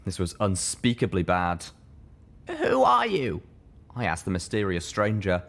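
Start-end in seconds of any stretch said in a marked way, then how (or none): 2.5-3.4 high, wary question; a hurt young mare, uneasy; young mare's voice. Acted. Littlepip questioning the stranger, higher voiced.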